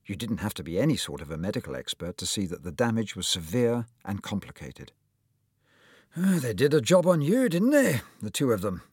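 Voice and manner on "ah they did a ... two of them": put-on higher, louder, hearty old surgeon voice; lightly voiced cheery doctor; kindly old surgeon. A narrator's reading. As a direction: play the jolly surgeon greeting his patient. Surgeon reviews x-rays.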